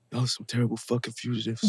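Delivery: a light hint of a Spanish-accented English, menacing; Armando's Spanish accent. Shown as a demonstration. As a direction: growl it softly as Armando the killer. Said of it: "Can you do Armando's voice real quick?".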